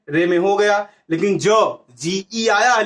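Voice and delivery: normal talking voice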